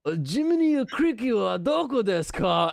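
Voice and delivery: loud, high mock-Japanese accent; samurai Japanese accent. Traveler imitating the translator's question, hammed-up samurai Japanese accent. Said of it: "like a samurai accent".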